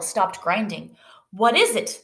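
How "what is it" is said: mild storybook shout; demanding question, higher pitched. A narrator's reading. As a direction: call out the question, slightly raised. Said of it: "the miller shouted".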